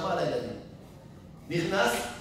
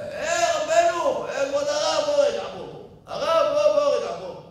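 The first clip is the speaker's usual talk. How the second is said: much higher, loud eager calls; calling out; 10 semitones higher, 8 dB louder, slower than usual. Retold or imitated. Moroccan beadle calling the rabbi over after prayers, loud calls. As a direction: wave him over, calling loudly.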